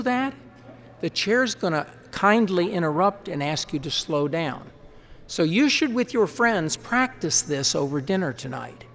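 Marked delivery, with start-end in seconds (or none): none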